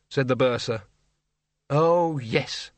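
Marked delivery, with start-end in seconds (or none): 1.7-2.7 sneering. A narrator's reading. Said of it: "sneered the Dean".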